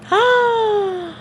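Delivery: long audible intake of breath; sharp gasp. Real, not acted. Streamer suddenly remembering something, gasp. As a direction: gasp as a memory hits.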